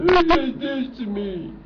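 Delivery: very high, loud, drawn-out whine; mock whining. Mimicking the other side's complaint, mock whining. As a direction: wail it like a put-upon complainer, hammed-up. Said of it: "People are whining about this".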